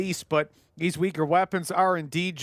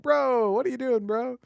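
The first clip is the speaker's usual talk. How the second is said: exasperated teammate; 7 semitones higher than usual. Retold or imitated.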